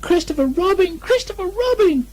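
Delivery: very high squeaky calls; crying out. Acted. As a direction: call out in relief. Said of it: "cried Piglet".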